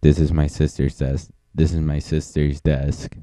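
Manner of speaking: ordinary talk, nothing marked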